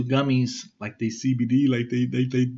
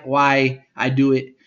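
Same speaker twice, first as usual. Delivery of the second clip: ordinary delivery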